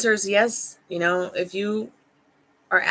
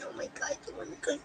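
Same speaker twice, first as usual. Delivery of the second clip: whisper; 11 semitones higher, 15 dB quieter, much faster than usual. Real, not acted. Hushed teasing to the dog.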